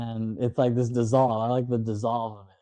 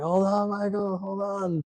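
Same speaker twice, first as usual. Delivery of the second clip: imitated calling out; 8 semitones higher than usual. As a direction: call it out high, as if over a train. Retold, calling out as train comes.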